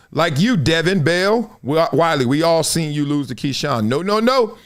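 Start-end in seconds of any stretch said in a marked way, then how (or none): none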